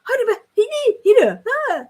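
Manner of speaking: high, loud panicked gibberish; freaking out. Retold or imitated. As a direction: imitate people shrieking in astonishment. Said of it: "They're freaking out. They're going".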